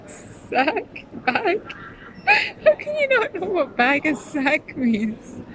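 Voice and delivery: high, exasperated mock voice of the American guy; a hint of an outraged American tourist. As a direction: squeak out his disbelief. Imitating annoyed American guy.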